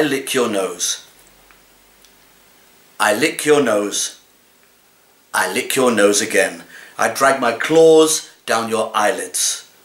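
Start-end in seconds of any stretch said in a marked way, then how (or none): none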